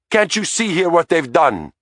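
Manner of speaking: put-on high, pleading Italian accent; desperate Italian accent. A narrator's reading. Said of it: "he said".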